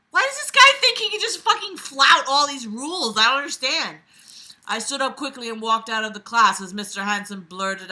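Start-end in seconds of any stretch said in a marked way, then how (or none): 0.1-4.0 loud, high-pitched exasperation; exasperated rant. Real, not acted. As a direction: rail in disbelief at the character.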